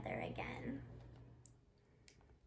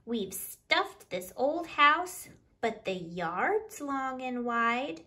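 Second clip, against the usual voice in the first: louder, lively picture-book reading in rhyme; reading to children; 6 dB louder than usual. Acted. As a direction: read the counting rhyme out to the little ones with bounce. Reads picture book aloud.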